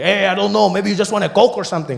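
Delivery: a loose, offhand street vendor; high, loud shrug to a hesitant customer; taco guy. Retold or imitated. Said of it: "he's like".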